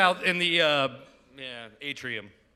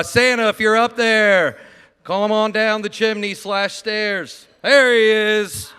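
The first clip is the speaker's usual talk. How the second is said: calling out; 4 semitones higher, 8 dB louder, slower than usual. Real, not acted. Calling Santa down at party.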